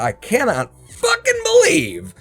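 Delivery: loud, outraged venting; furious rant. Real, not acted. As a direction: let the anger burst out. Venting his anger.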